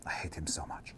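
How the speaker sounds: very soft, low whispered loathing; hateful whisper. Acted. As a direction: whisper your hatred under your breath. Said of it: "Last thing you hear Jarlaxle say".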